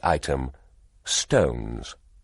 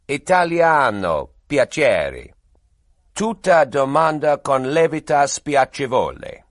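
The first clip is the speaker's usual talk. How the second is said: slow, louder Italian rendering; Italian translation; 8 semitones higher, 6 dB louder, slower than usual. Acted. Translator repeating the protest in Italian, slow and louder. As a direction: deliver it in careful Italian.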